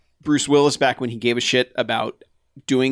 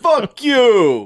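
loud, high, drawn-out mock insult; hammed-up brash yell; Andrew Dice Clay; 9 semitones higher, 6 dB louder than usual. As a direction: holler it like an obnoxious comic. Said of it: "he was like".

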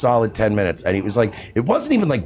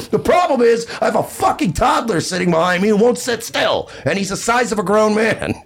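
high, heated rant; retold angry outburst; 9 semitones higher than usual. Flyer snapping at fidgety man behind, high angry rant. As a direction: raise the pitch and let the complaint rip.

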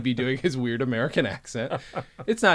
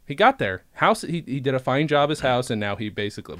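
ordinary delivery

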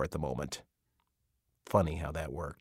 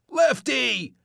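much higher, louder shout of the name; desperate yell; 7 dB louder than usual. Acted. Gary yells desperately after Lefty speeding past. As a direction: scream it after the car.

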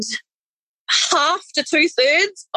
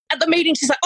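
ordinary talk

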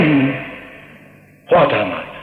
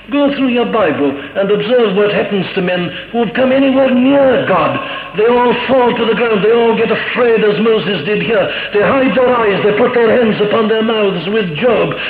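higher and louder, pressing the congregation with awe; preaching at full force; 6 semitones higher, 3 dB louder than usual. Acted. Preacher urging his congregation toward awe, at full force. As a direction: build the fervour as if warning the whole church.

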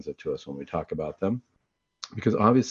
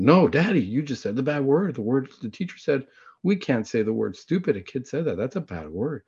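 imitated kid's higher-pitched protest; child correcting Dad; 5 semitones higher than usual. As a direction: scold Daddy like an earnest little kid. His child correcting Dad, acted out.

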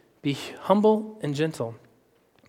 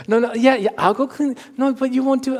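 higher, a hint of a fussing wife; mock wife, higher and fretful; lightly voiced wife; 9 semitones higher than usual. Wife insisting she'll clean, lightly higher voice.

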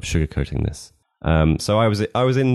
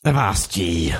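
put-on old sailor. Pirate voice reading review.

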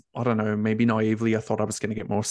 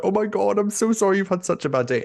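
higher, quicker gushing sympathy; sympathetic graduate; 10 semitones higher than usual. Retold or imitated. Graduate hugging her teary manager, gushing higher sympathy. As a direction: rush to comfort someone you've caught crying.

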